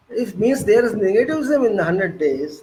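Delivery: normal talking voice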